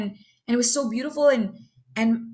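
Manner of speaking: ordinary delivery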